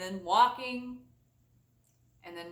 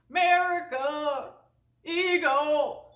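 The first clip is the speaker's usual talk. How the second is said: mock patriot; 5 semitones higher, 4 dB louder, slower than usual. Teasing America's pride in a pompous mock voice. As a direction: proclaim it like a proud patriot.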